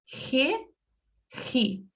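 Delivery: re and ri scraped at the back of the throat; throaty scraped r. Shown as a demonstration. Teacher showing the Spanish G sound to Brazilians, throaty. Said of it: "aquele R raspado no fundo da garganta".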